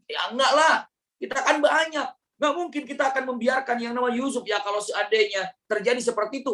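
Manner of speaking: mock-sincere brothers reassuring their father; brothers protesting. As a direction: brush off the worry with false cheer.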